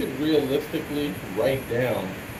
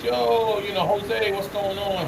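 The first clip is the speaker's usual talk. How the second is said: higher, casual street greeting; friend's greeting; 8 semitones higher, slower than usual. Retold or imitated. Friend greets coworker Jose in the mall, higher casual hail. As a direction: call out to a coworker you just spotted, higher. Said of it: "So my friend said".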